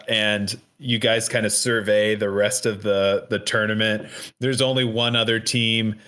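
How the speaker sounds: usual speaking voice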